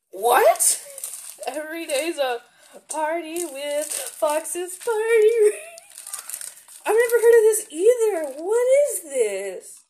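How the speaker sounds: loud, high thrilled exclaiming; excited amazement. Real, not acted. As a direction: burst out in delight. Excited discovery of candy.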